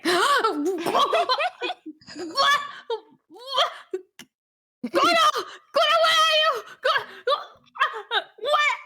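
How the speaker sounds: high yelps of confusion; startled confusion. Pretend. Roommate waking to find a cow, calling out. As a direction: call out for Kuro in alarm.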